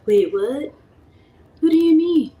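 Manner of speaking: high, loud baffled outburst; bewildered. Retold or imitated. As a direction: sound totally lost. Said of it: "I was like".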